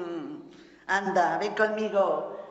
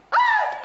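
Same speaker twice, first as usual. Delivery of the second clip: a piercing high cry, much louder; shrill hysterical shriek; 5 dB louder than usual. Acted. Doña Remedios, accused of lying, shrieks hysterically.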